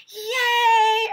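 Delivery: very high, very loud cheer; cheering yay. A narrator's reading. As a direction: scream with joy like kids. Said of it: "everyone yelled".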